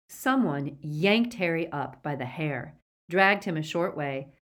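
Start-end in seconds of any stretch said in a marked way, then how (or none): none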